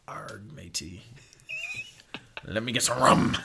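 a mock pirate ordering rum at the bar. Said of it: "just be an absolute fucking pirate".

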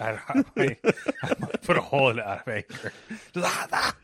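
laughing through the words; giggling while joking about punching. Real, not acted. Laughing at own anger idea.

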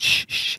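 soft drawn-out "shh, shh"; shushing. Retold or imitated. Johnny shushing people who start to recognize him, hushing. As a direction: imitate hushing people with a finger to the lips. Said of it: "puts his fingers in his mouth".

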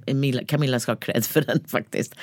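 ordinary talk, nothing marked

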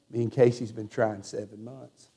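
low, hushed imitation of a broken man; retold breaking down. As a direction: voice his heartbreak softly, very low. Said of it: "he just really broke".

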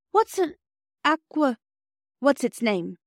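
high start, stumbling over the word; halting question, pitch dropping step by step; puzzled child. Acted. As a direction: grope for a new word like a puzzled girl.

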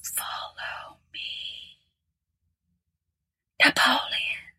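slow, eerie spectral whisper; a ghost foretelling doom, slowly; hollow ghostly whisper. Acted. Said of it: "whispered a hollow voice in a deep, mysterious tone".

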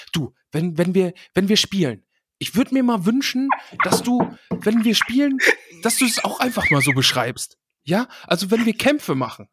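a hint of a whiny voice message; game master voice message. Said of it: "eine vier Minuten Sprachnachricht schicken von wegen".

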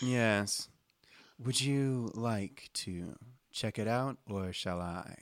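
slow, soft, deliberate delivery; measured librarian agent voice; sleepy office librarian. Acted. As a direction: deliver the briefing slowly and quietly, word by word. Game master voices Connie.

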